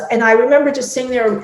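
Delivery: ordinary talk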